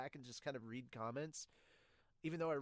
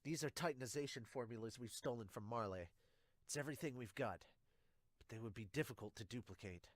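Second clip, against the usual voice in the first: lower, softer dramatic reading of soldiers' talk; comic dialogue reading; 6 semitones lower, 4 dB quieter than usual. Acted. Reader voicing Pixis, Zeke and Levi in a low, hushed reading. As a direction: read it like quiet war-room talk.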